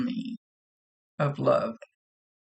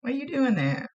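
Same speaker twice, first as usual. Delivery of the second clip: higher teasing scold, lightly voiced; playful higher-pitched jab; teasing spirit brother; 9 semitones higher than usual. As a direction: needle her like a cheeky brother. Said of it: "They're always bopping me over the head".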